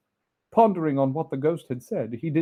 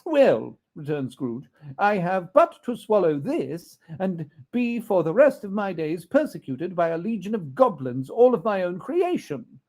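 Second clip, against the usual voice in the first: put-on higher, loud blustering old miser; Scrooge voice; 5 semitones higher, 4 dB louder than usual. Scrooge scoffing at the ghost, higher and loud. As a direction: bluster to hide the fear.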